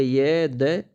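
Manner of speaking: usual speaking voice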